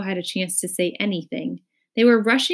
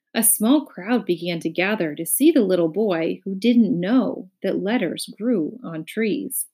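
usual speaking voice

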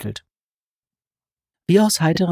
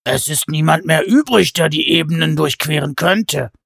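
giant correcting; 4 dB louder than usual. A narrator's reading. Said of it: "stellte der zweite richtig".

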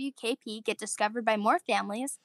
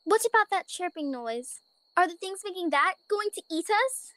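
put-on higher, anxious child voice; worried little bunny; 7 semitones higher than usual. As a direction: ask like a scared little kid. Bella character voiced.

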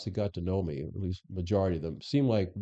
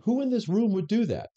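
challenging; 12 semitones higher, 4 dB louder than usual. Retold or imitated. Challenging officers at town hall.

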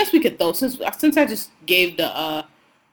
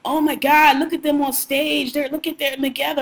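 higher, gushing mock excitement; hammed-up fangirl gush, pitched higher; mock gushing fans; 7 semitones higher than usual. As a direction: imitate fans swooning over a couple on stage. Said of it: "everybody was getting so carried away with".

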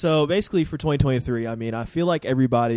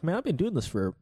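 surprised; 7 semitones higher than usual. Retold or imitated. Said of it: "you look back over months like".

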